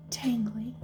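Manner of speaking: single word whispered. Acted. Fairy whispers the word tangly to Jaya. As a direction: breathe the word.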